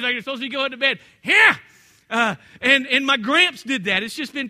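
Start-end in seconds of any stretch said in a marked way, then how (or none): 1.2-1.6 loud, high, sharp bark of a call; a father shouting at the dogs; dad's shout at dogs. Retold or imitated. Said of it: "Us boys would be making too much noise".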